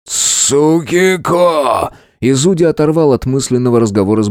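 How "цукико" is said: pained cry. A narrator's reading. Dying friend calls out.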